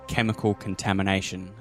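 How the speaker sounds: ordinary delivery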